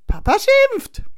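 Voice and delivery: very high, loud wail; high-pitched cry of dread; alarmed child's cry. A narrator's reading. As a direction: warn like a frightened little boy. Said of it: "ruft Florian".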